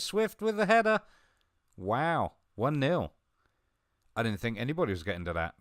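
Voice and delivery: loud, high play-by-play rising to a shout; excited match call, peaking on the header; live goal commentary. Real, not acted. Gamer calls his team's headed goal, loud excited commentary.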